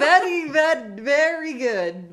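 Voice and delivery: high, loud, drawn-out mock Russian accent; Russian accent faltering. Host mimics friend catching herself mid-phrase, Russian accent breaking off. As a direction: start mock Russian, correct yourself slowly, amused. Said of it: "realized partway through that the guy was actually Russian".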